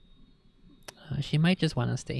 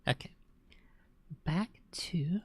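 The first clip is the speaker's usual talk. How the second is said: ordinary delivery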